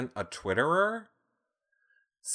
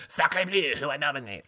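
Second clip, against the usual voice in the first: put-on hammy French accent, pitched up; lightly mock the French; 3 semitones higher than usual. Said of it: "He is French".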